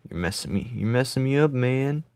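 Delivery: ordinary talk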